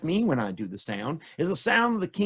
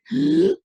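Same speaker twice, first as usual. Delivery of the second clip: gasp; 10 semitones higher, 6 dB louder than usual. Acted. Giant tries to whistle.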